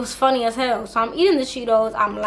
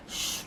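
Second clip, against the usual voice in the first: long airy shh for flying hair; imitated hair swish; 15 dB quieter than usual. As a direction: imitate a slow-motion commercial whoosh. Imitates slow-motion hair swish.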